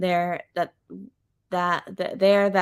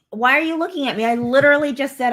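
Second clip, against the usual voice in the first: higher, louder protest; real mild indignation; 5 semitones higher, 7 dB louder than usual. Real, not acted. Telling co-host she doesn't know the explorer, indignant. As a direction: push back, half exasperated.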